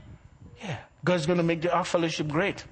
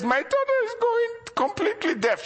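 much higher, pleading mother's voice; imitating crying mother. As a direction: voice a distraught mother, high-pitched. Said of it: "the mother was crying".

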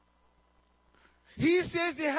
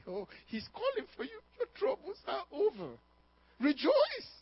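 higher, soft, excited crowd voice; imitating cheering crowd; 4 semitones higher, 4 dB quieter than usual. Crowd voiced telling blind man.

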